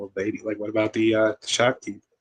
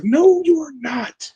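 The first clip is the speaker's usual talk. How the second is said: much higher, louder and slow, no way; emphatic denial; 6 dB louder, much slower than usual. Retold or imitated. Mocking the movie's Detroit claim, slow emphatic denial. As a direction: stretch it out in flat disbelief.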